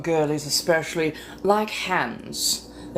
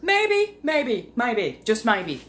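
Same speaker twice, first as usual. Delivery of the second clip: slight Australian accent; 6 semitones higher, slower than usual. Real, not acted. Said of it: "went slightly Australian after getting flustered".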